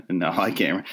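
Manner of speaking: ordinary talk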